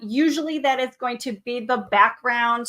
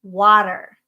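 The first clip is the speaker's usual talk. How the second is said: water said louder with a falling end; stress on water; 3 semitones lower, 6 dB louder than usual. Shown as a demonstration. Teacher to her art students, stressing water. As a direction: lean on the word water, slow and firm. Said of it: "is to emphasize the word".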